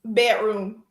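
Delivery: dropping the d in Bedroom, said like "Betroom". Shown as a demonstration. Guest showing the one word she says with an accent. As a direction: Bedroom with no d sound, "pætrum".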